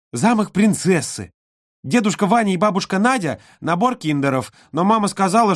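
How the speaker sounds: put-on high, excited little girl. A narrator's reading. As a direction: gush like an eager little girl.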